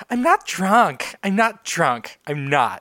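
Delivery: put-on high, slow tipsy party girl; drunk girl impression. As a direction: play a tipsy girl denying it.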